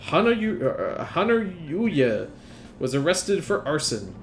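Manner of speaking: slow, formal newscaster read; news anchor. Acted. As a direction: announce the headlines like TV news. Reads in-game news broadcast.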